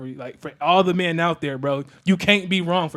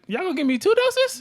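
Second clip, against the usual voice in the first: high-pitched alarmed question; comic panic, voice shooting way up; retold scared; 13 semitones higher than usual. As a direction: act out nervous disbelief at the doctors. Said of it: "so I'm kinda scared".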